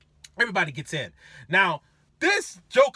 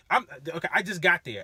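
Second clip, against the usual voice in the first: usual speaking voice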